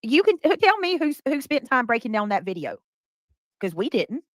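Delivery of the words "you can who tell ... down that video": incredulous high call-out. Real, not acted. Host challenging a claim in disbelief, high and incredulous. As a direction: pitch jumps high in disbelief.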